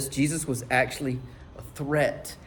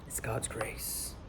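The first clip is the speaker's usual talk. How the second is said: whisper; 3 semitones lower, 14 dB quieter, slower than usual. Acted. Preacher's hushed slow punchline.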